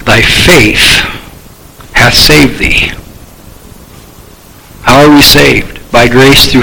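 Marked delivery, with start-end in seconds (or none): 0.0-2.9 tender quiet. Acted. Jesus' gentle words.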